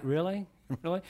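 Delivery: soft, rising skeptical question with a chuckle; quietly doubt it, amused; mock-incredulous little query; amused disbelief. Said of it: "God's like".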